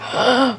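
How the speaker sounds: quick mock gasp of delight; excited gasp. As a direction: gasp as if thrilled about breakfast. Acts excitement about breakfast.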